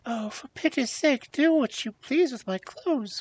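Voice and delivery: high, mildly miserable princess; miserable cry. A narrator's reading. Princess giving up her clothes, miserable. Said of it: "cried poor Celandine miserably".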